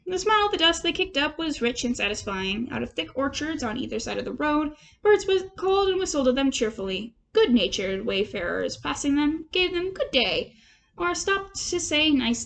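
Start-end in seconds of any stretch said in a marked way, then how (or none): none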